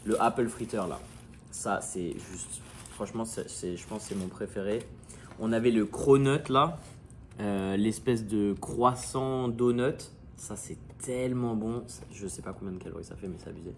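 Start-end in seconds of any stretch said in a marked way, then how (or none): none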